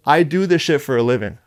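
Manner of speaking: ordinary delivery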